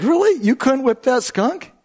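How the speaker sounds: high, incredulous monkey voice; mocking monkey. Monkey needling the lion over the skunk, high and incredulous. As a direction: jeer at the lion in disbelief.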